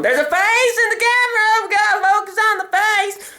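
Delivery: very high, singsong alarm; excited camera voice. Acted. Camera spots a face, squeaky singsong excitement. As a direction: squeal it like an overexcited gadget. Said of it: "the camera's going".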